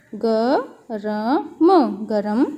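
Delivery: "ग", "र", "म" with pauses, then "गरम" whole; spelled out. Shown as a demonstration. Teacher spelling "गरम" slowly for pupils. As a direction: spell it sound by sound, then join it. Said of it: "तो यहाँ पर हम लिखेंगे".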